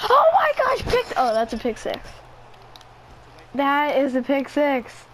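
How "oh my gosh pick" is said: high-pitched shout of shock; real sudden burst of alarm; startled outcry. Real, not acted. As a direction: cry out high at the interception. Reacting to an interception.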